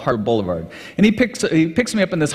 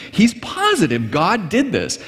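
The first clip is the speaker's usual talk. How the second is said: ordinary talk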